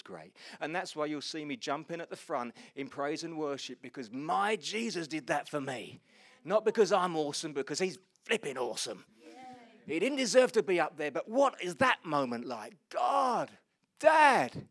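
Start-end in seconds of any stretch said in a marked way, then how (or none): 3.8-12.7 loud, surging preacher's delivery; full-force preaching. Real, not acted. Preacher exulting in what Jesus did for him, full-force preaching. 12.9-14.7 high, very loud cry to a father; anguished cry. Acted. Jesus on the cross calling to his Father, anguished shout.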